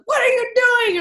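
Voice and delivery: husband's furious yell, high and loud; angry shout. Retold or imitated. Husband yelling at wife who pushed cows wrong, loud angry shout. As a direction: yell it at her like she's ruining everything. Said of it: "And he goes".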